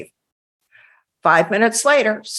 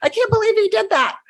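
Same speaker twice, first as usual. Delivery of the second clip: high-pitched mock disbelief; imitated astonishment; 11 semitones higher than usual. Listeners reacting to what the captain did, imitated amazement. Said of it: "their eyeballs fall out of their head".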